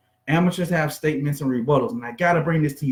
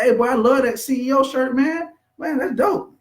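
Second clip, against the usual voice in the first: lightly acted higher-pitched compliment; admiring stranger; 6 semitones higher than usual. A passerby compliments someone's shirt, admiring.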